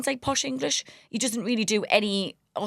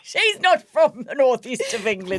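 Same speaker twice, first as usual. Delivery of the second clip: laughing; slower than usual. Real, not acted. Correcting a friend while still laughing. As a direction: laugh through the words, slowly.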